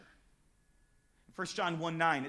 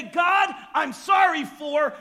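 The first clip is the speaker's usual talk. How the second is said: loud, slow and higher, owning it; bold confession; 8 semitones higher, 11 dB louder, slower than usual. Retold or imitated. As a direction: say it like you mean it. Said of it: "man up to it and say".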